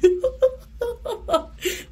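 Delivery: real laugh close to crying; high laughing squeal. Real, not acted. Laughing at dog staring.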